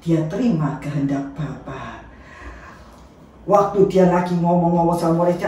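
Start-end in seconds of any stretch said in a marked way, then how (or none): none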